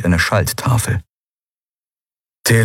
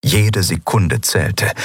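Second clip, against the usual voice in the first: usual speaking voice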